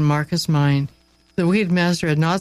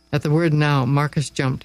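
ordinary delivery, nothing marked

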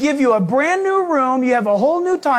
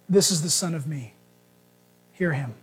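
lower, softer solemn voice of God; voice from the cloud; 7 semitones lower, 6 dB quieter than usual. Retold or imitated. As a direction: speak low and hushed as the voice from the cloud. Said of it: "a voice comes out of the cloud and says".

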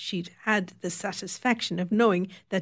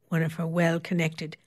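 usual speaking voice

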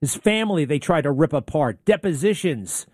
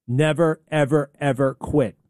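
normal talking voice

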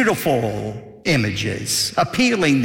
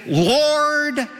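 pleading cry; 13 semitones higher than usual. Acted. Sinners on judgment day crying out to the Lord, pleading. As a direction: beg the Lord like a desperate soul.

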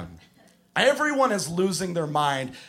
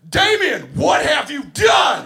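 high, horrified full-force shout; top-of-the-lungs yell; 9 semitones higher, 8 dB louder, slower than usual. Retold or imitated. Coach who just saw the ball stolen yells at the kid, unhinged full-volume shout. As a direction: bellow it as if losing your mind. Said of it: "at the top of my lungs, I yell".

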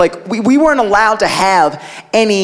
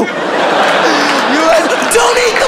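mock outrage; 7 semitones higher, slower than usual. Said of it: "You're not gonna be like".

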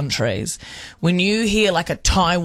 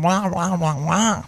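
American accent mockery; slower than usual. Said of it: "do an American accent".

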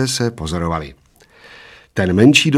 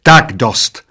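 short, loud cut-off cry; understated shriek, loud but barely raised; waitress's yell; 2 semitones higher, 7 dB louder than usual. A narrator's reading. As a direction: put a loud stop to it. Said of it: "zaječela číšnice".

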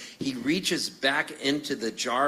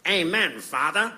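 a lightly imitated celebrity at communion; Bob Hope; 5 semitones higher than usual. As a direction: say it as a knowing little performance. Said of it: "looks at the priest and goes".

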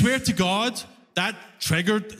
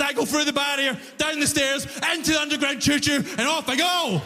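high-pitched, exasperated rant; losing it; 7 semitones higher than usual. Retold or imitated. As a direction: lecture him in one high, exasperated tirade. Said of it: "I lost it".

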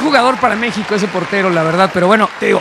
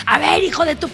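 raised pitch, imitated angry outburst; mock cursing; 4 semitones higher, 3 dB quieter than usual. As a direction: imitate a keeper cursing out his defender. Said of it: "una mentada de madre en coreano".

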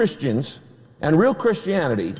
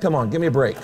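usual speaking voice

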